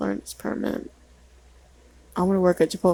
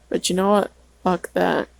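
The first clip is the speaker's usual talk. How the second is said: ordinary talk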